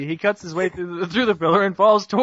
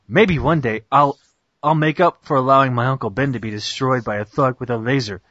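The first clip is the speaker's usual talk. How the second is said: mock heroic narration; 7 semitones lower than usual. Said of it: "Spider-Man's narrator. Wait".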